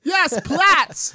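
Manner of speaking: laughing through a high, loud fake German accent; mock German accent. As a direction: insist on the word like a proud German. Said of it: "terrible German".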